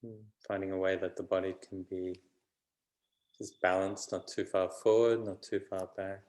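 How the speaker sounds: unhurried meditation voice with long pauses; calm, even delivery, phrase by phrase; guided meditation. Real, not acted. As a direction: gently guide them inward, leaving space between phrases.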